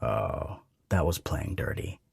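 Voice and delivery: low, hushed and breathy. A narrator's reading. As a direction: let it slip out softly. Aroused breathy reaction.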